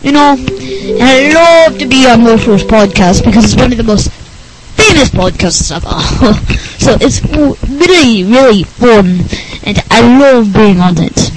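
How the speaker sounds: goofy, gushing nerd character; put-on nerdy voice, keen and swooping in pitch; Rick Berner. Nerd guest delighted to be back, goofy gushing.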